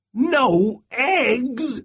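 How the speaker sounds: high, indignant cry; angry cry. Acted. Farmer crying out about the hens' strike, high and indignant. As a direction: yelp it in outrage. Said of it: "cried Farmer Brown".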